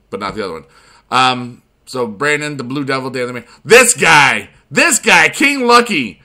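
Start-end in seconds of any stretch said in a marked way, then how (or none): 3.6-6.2 excited. Real, not acted. Hyping up a rival player.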